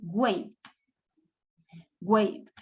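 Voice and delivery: low one-syllable "Waved", V into D; D-only ending. Shown as a demonstration. Teacher models the last consonant, D added without a syllable. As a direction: say "Waved" with a silent E. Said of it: "cuál es la última consonante".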